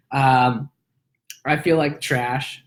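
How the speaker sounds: ordinary delivery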